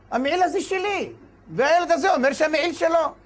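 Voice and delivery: aggrieved father arguing his case; indignant claimant. Acted. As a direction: protest your claim to the judge. Father pleads his claim to rabbi.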